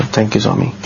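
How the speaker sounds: ordinary talk, nothing marked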